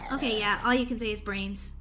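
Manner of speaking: normal talking voice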